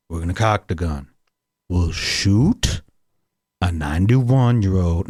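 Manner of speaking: low-pitched, deliberate conspirator voice; mock plotter. Imagined man plots to shoot passerby, mock low scheming. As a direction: lay out the plan low, in measured pieces.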